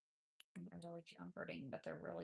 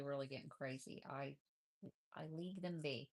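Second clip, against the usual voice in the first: usual speaking voice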